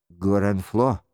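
ordinary delivery

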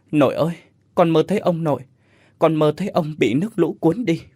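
a scared boy tearfully telling his grandma a bad dream; whimpering. A narrator's reading. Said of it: "Nhật mếu máo nói". (mếu máo, giọng cao hơn)

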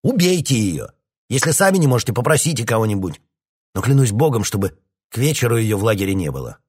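shouting at the captain to kill the dog; angry order. A narrator's reading. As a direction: tear into him. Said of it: "набросился на него Грей".

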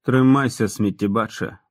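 plain reading voice

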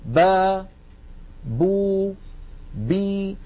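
با، بو، بي said crisply, lips pressed and released; syllable demo. Shown as a demonstration. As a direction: pop each syllable off the lips. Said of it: "ثم نقول".

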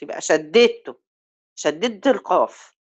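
ordinary talk

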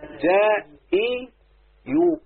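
جاء، اي، يو stepping up in pitch with gaps; syllable by syllable. Shown as a demonstration. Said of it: "اقول ايه؟". (نطق جاء، اي، يو مقطعًا مقطعًا بنغمة صاعدة)

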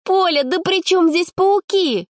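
high drawn-out disbelief; exasperated girl. A narrator's reading. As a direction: roll your eyes at a naive friend.

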